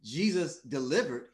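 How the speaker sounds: ordinary talk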